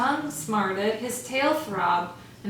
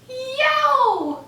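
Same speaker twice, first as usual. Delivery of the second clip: high loud yelp of pain; sudden squeaky howl; 7 dB louder than usual. Acted. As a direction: yelp it out, mouth on fire. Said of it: "he howled".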